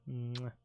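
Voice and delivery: playful kiss sound smack; goodnight kiss. Pretend. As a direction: blow a loud smooch. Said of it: "You want another one?".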